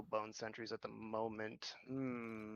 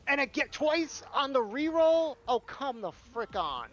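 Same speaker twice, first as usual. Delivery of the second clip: high, loud and drawn out; real frustration, stretched words; exasperated outburst; 12 semitones higher, 11 dB louder, slower than usual. Real, not acted. Rerolled into same bad card.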